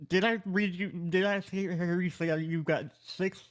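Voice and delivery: ordinary delivery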